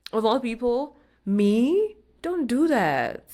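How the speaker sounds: high, loud playful scolding; mock indignant. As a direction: act affronted, rising on me, then drawing out the telling-off. Mock scolding listeners for quitting halfway.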